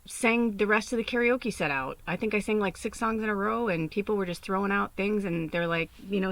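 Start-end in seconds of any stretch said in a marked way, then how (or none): none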